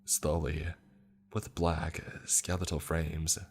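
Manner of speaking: ordinary delivery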